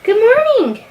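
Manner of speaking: high, lilting good morning. Real, not acted. Mom says good morning to her baby, high baby-talk. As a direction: coo it high to a little one. Mom greets just-woken toddler.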